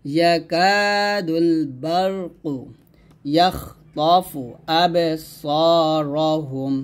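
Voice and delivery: slow, drawn-out tajweed chant; Quran recitation. Shown as a demonstration. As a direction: recite each word long and measured. Teacher recites Quran verse slowly. (ধীর তাজবীদ তিলাওয়াত)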